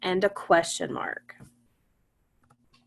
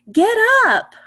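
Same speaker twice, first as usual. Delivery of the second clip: much higher and louder, with excitement; excited exclamation; 11 semitones higher, 8 dB louder than usual. Shown as a demonstration. Teacher demoing an exclamation to young readers, excited. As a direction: read it like an exclamation point. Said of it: "that was with an exclamation point".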